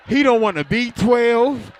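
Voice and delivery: laughing through the line; can't hold the laugh in; giggling at his own punchline. Real, not acted.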